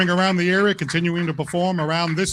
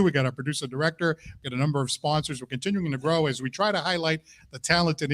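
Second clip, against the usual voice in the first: ordinary talk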